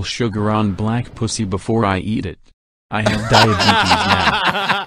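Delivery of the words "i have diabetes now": slow, laughing delivery; laughing punchline. Real, not acted. Reader delivers the joke punchline while laughing.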